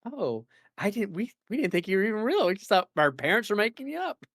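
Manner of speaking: high, amazed little villager; astonished villager voice. Retold or imitated. Villager meeting a mythical returning player, high and amazed. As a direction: act the villager stunned you are real. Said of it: "and then like".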